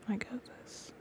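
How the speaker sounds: breathy whisper close to the mic. Real, not acted. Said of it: "I don't know why I be whispering".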